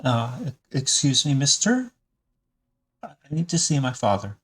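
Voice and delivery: put-on high, hesitant young voice pleading; anxious son edging past a stranger; concerned boy. Son asks stranger to let him see father, high and anxious. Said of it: "starts to look a little concerned and says".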